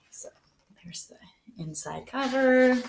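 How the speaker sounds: slow hushed whisper to the class; whispered aside while showing the inside cover. Acted. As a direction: breathe it out slowly, secret-like. Teacher showing the book cover.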